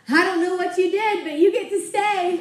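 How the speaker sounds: spring the happy surprise; good news. Retold or imitated. Boss revealing good news.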